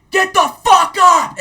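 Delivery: full-force high-pitched barked command; drill sergeant shouting. Retold or imitated. Drill sergeant waking the platoon below, shouting. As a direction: yell it out to rouse a barracks. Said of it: "and then so I hear".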